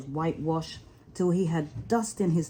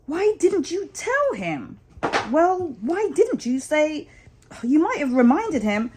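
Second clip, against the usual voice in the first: grumbling rabbits; 8 semitones higher than usual. Acted. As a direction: bicker as a peevish rabbit. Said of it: "all started grumbling".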